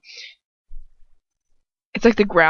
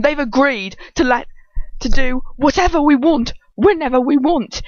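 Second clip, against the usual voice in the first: higher, louder acted glee; triumphant announcement; 6 semitones higher, 6 dB louder than usual. A narrator's reading. Dolohov telling Lucius they've agreed, triumphant. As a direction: crow the news to the room.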